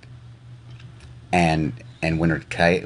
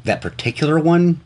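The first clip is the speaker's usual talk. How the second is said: incredulous; 9 semitones higher than usual. Real, not acted. Incredulous echo of partner's words.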